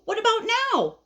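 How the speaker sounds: high, eager nagging question; pestering caterpillar. Acted. Caterpillar keeps asking his friend, pestering. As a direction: nag with a high upturned voice. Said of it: "So now he says".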